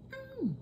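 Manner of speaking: squeaky hum of pleasure; high delighted mm. Real, not acted. Tasting the dessert.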